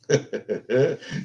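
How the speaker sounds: short amused laugh; brief run of chuckles; real chuckle. Real, not acted. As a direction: chuckle knowingly. Teasing about a Southern accent.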